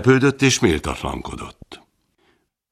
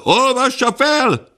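much higher, slow, drawn-out cry; a second voice insisting louder; the crowd growing impatient, pitch soaring; shrill crowd demand; 3 dB louder, slower than usual. Acted. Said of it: "követelte több hang".

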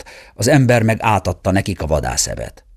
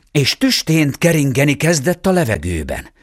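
plain reading voice

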